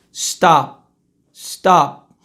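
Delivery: "stop" with an almost imperceptible p; barely audible p. Shown as a demonstration. Teacher repeating the demo, "stop" with a hidden p. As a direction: swallow the final p of "stop", lips closed. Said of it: "ele fica quase que imperceptível".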